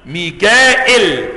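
ميكائيل said slowly, hamza after the alif and no ya; slow, careful ميكائيل with hamza and no ya; without ya. Shown as a demonstration. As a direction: draw out ميكائيل with a clear hamza, skipping the ya. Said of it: "ميكائيل بهمزة بعد الألف من غير ياء".

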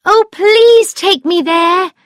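very high, loud, eager plea; thrilled storybook young woman; excited. A narrator's reading. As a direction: beg with bubbling excitement. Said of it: "she said in an excited voice".

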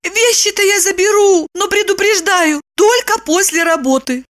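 high-pitched woman's character voice; warning Lipochka. A narrator's reading. Woman agrees to fetch his things, high warning voice. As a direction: warn him in a high voice. Lipochka answers Roman's request.

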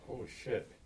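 strained, hushed groan of pain; pained from a cramp. Real, not acted. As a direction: squeeze it out through a leg cramp. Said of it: "I feel the one muscle".

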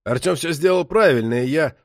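a touch of father's indignation; starting to raise his voice. A narrator's reading. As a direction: push back with rising irritation. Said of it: "стал повышать голос и Токарев".

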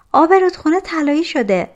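high, loud, quick call; excited announcement. A narrator's reading. Girl runs into the hut with news of the river, excited. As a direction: burst in with the news, pitched high. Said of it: "به داخل کلبه دوید و گفت".

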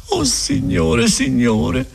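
high tearful lament, a touch of a sob; almost crying. Acted. Agnese losing hope before the friar, almost crying. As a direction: cry out near tears. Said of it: "quasi piangendo".

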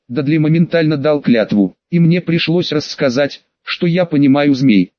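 plain reading voice

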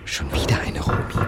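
leise geflüsterter Gedanke; flüster es genervt vor dich hin. Acted. Gedanke, leise für sich. (geflüstert, genervt)

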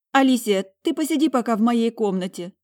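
high, loud, upset appeal; tearful plea. A narrator's reading. Crying Talia keeping her sister from the room, pleading. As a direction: plead with her to stay away. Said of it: "Выдохнула она и вытащила".

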